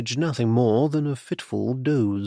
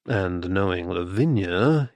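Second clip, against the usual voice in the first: plain reading voice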